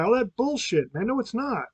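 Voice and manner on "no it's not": normal talking voice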